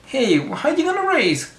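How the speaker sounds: cartoon mascot. Retelling the ad, a lightly voiced mascot taunt. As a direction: toss it out like a playful mascot. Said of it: "And it says".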